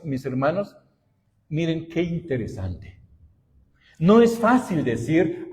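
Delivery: usual speaking voice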